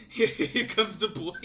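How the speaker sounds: drawn-out joke announcement through laughter; laughing meme announcement. Acted. Creator joking about the project's silly title, laughing. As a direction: draw it out, breaking into giggles.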